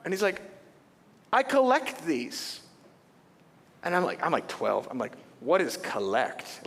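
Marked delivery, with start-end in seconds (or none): 1.3-2.6 matter-of-fact. Retold or imitated. Collector kid calmly explains. 5.4-6.7 baffled. Retold or imitated. Kid baffled by the idea.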